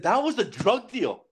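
high, urgent announcement; excited. Retold or imitated. First telling his buddy what they saw, high and urgent.

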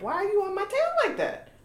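high, sing-song complaint at a tailgater; mock annoyed driver. Wife mocking a tailgater, high sing-song. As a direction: needle the driver behind you, lightly.

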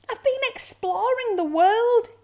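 very high, loud cub voice; excited cub. A narrator's reading. Cub tells mother of adventure, high and excited. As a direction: announce the adventure with pride.

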